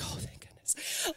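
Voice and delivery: softly whispered relief; relieved whisper. Retold or imitated. Tired mom whispering relief as grandma takes the spoon. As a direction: breathe it out in secret relief. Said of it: "I'm like".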